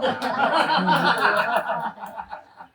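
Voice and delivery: real laugh, lighter. Real, not acted. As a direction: chuckle it out. Laughs again. (mahinang tawa)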